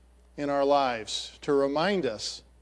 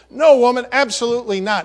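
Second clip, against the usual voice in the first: loud, high, vehement no; furious denial; 7 semitones higher, 8 dB louder, faster than usual. Acted. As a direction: shout it down hard. Peter's vehement third denial.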